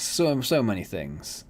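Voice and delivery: "So, so" stretched long and slow, falling at the end; slow drawn-out repeat. Real, not acted. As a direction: let each so drag out in weary disbelief.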